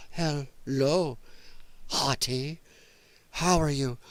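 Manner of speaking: broken-up, low mock come-on; mocking her odd voice. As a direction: imitate a stilted woman greeting someone, word by word. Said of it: "who she's gonna be going".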